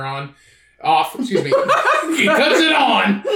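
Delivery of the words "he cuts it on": high, drawn-out cry through laughter; high-pitched laughing outburst; laughing mock exclamation. Real, not acted. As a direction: crack up while blurting it out slowly. Mocking own slip while laughing.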